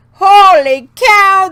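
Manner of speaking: loud, slow holy cow; real exasperated outburst; exclamation. Real, not acted. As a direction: blurt it out loud and drawn out. Venting frustration at software company.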